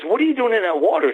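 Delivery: high, mock yell of a fifteen-year-old; imitating a kid shouting from the riverbank; teen kid's voice. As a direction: pitch it up like a curious teenager. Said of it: "he goes".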